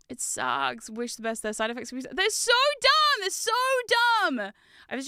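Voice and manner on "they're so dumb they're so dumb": high, slow whiny complaint; exaggerated whine. Real, not acted. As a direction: whine it out dramatically.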